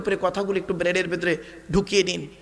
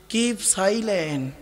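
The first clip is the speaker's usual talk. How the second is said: drawn out; much slower than usual. Shown as a demonstration. Mimics office sign.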